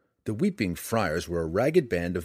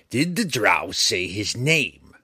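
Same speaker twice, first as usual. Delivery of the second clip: louder, slow threat up close; menacing; 5 dB louder, slower than usual. A narrator's reading. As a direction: press him hard and slow. Repeats, breath hot on face.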